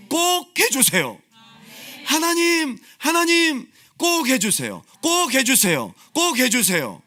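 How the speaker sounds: high, loud cry to God begging him to act; desperate plea. Acted. Preacher voicing the psalmist's cry to God, desperate loud plea.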